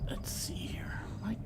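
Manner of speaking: whispered aside; muttered to self. Real, not acted. Player checking the screen, whispered. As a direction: breathe it to yourself while searching.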